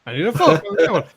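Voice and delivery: light mock Italian accent. Italian tourist insisting to the waitress, light mock accent. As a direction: repeat it in the tourist voice. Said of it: "the Italian".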